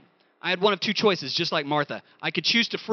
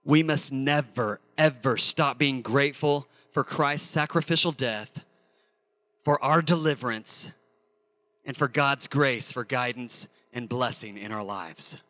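solemn quiet exhortation; 7 semitones lower, 4 dB quieter than usual. Real, not acted.

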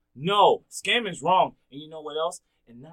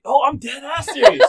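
laughing insistence; 9 semitones higher, 13 dB louder than usual. Real, not acted.

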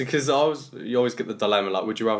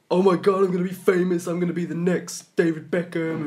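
high, loud gushing fame-hungry contestant; over-excited wannabe; 9 semitones higher, 4 dB louder than usual. Retold or imitated. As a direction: gush like a reality show hopeful. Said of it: "over-exaggerated people".

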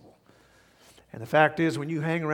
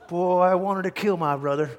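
ordinary talk, nothing marked

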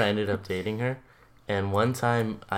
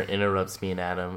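ordinary talk

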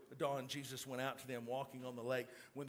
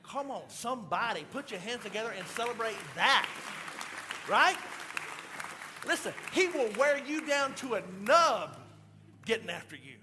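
loud, high, impassioned preaching; shouting preacher, swept up in excitement; full-force preaching; 10 semitones higher, 13 dB louder than usual. Acted. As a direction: preach it out at the top of your voice.

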